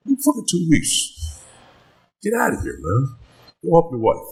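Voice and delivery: high-pitched retold brush-off; gruff-kindly dismissal. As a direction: imitate a boss waving the notice away. Acting out boss dismissing his notice.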